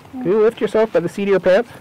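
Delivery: high, fast teasing question. Real, not acted. Asks toddler playfully.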